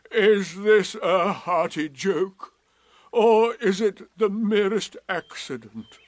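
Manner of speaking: dry and put upon; Eeyore. A narrator's reading. Eeyore mocking Rabbit's question, slow and dry.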